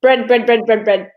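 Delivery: "Bread" rattled off quickly five times; rapid-fire drill of "bread"; quick tongue-twister drill; fast repetition. Shown as a demonstration. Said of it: "Say it five times really fast".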